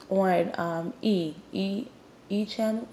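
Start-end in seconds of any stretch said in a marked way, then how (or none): none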